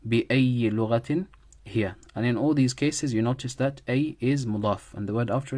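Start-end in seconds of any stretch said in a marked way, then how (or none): 0.1-1.9 بأي said "bi-ayyi", ending in "i"; kasra ending. Shown as a demonstration. Teacher recaps the cases, "bi-ayyi" for بأي. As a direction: give بأي a final short "i". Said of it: "Sometimes it takes a kasra".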